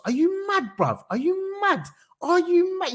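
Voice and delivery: can't believe what you're hearing; incredulous. Real, not acted. Ranting at politician's policy.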